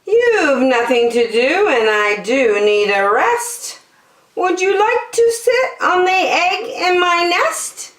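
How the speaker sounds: high, sweet persuasive bird voice; high coaxing bird. Acted. Lazy bird coaxing the elephant, high and sweet. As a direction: wheedle him into it.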